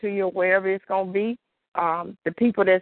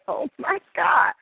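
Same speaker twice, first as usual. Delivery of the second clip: high, slow laughing gasp; surprised laugh; 9 semitones higher, slower than usual. Retold or imitated. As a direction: catch yourself out, laughing. Realizing the lady was there.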